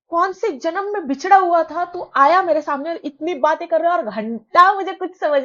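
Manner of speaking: fed-up loud rant; exasperated rant. Retold or imitated. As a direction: vent at him, fed up and loud. Frustrated rant at chatty guy.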